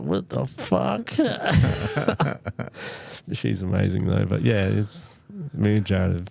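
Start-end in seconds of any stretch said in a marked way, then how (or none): 0.0-1.1 higher, slow, drawn-out Italian-free what the fuck; mock horrified wife. Imagining his wife meeting him at twenty-five, horrified and slow. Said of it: "you wouldn't be like". 1.2-3.3 breathless high-pitched wheeze of laughter; high wheezy laugh. Real, not acted. Laughing at his own joke, a high breathless wheeze.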